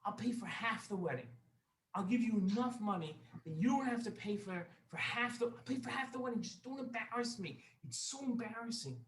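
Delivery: high-pitched, urgent pleading; desperate bargaining. Acted. Embarrassed guest offers money to be left alone, desperately pleading.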